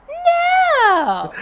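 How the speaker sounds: sharp high squeaky No; mock Kamala refusal. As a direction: shriek the No in mockery. Mocking Kamala's flat refusal.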